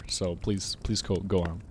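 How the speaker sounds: ordinary talk, nothing marked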